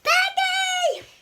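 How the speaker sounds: put-on a child bellowing for his friend; calling out. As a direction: yell her name across the room. Gerald calls out for Piggy.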